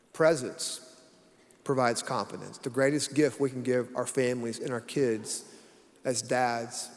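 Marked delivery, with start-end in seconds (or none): none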